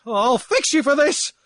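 high, loud screaming threat; furious drunk screaming revenge; screamed threat. Acted. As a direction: scream it at him. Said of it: "Aloysius screamed".

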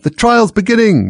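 high, loud cry; loud high announcement cry; distant cry. A narrator's reading. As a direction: call it out from afar. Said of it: "a cry of".

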